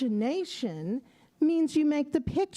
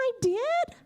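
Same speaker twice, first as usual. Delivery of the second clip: surprised; 9 semitones higher than usual. Acted. Surprised he made the picture.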